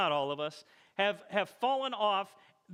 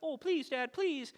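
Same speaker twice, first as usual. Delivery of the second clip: put-on high, pleading kids' voice; a sad-panda plea to Dad; pleading little girls; 7 semitones higher than usual. Daughters begging Dad, high and pleading. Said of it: "sad face, sad panda".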